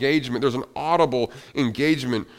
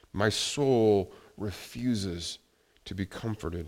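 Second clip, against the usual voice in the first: hushed despair; 4 semitones lower, 5 dB quieter, slower than usual. Acted. Quoting psalm, despairing.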